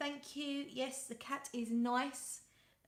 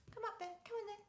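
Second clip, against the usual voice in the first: talking to the cat; 10 semitones higher, 8 dB quieter, much faster than usual. Real, not acted. Coaxing her cat Sweetie.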